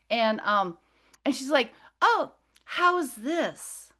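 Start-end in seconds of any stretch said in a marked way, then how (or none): 2.0-3.9 high, drawn-out alien woman; slow, higher feminine voice; female alien. Retold or imitated. Alien woman offering a new shape, high slow voice. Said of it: "her voice seemed more female".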